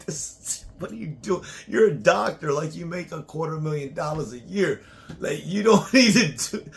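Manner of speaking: high, loud protest breaking into laughter; laughing protest. Retold or imitated. Husband retelling his protest to his doctor wife, laughing. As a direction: plead with her through laughter. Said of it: "I'm like".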